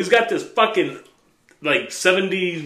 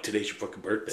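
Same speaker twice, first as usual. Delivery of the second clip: hushed; 8 semitones lower, 14 dB quieter, faster than usual. Retold or imitated. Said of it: "I'm like".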